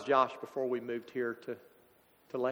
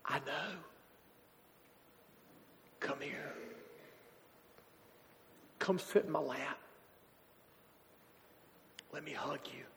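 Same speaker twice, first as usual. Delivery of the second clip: much softer, near whisper, gentle and consoling; tender comfort; 12 dB quieter than usual. Retold or imitated. Jesus welcoming Josh into his lap, soft tender whisper. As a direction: soothe him like a father welcoming a child home. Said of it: "I can hear Jesus saying".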